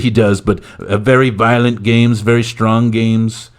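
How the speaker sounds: mock political bluster; Donald Trump. President defending the leprechaun to the press, blustering parody.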